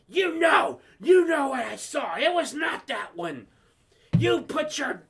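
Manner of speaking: high, loud accusing shout; scolding bus driver. Retold or imitated. Bus driver arguing with a student, shouted scolding. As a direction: lay into the kid, loud and high.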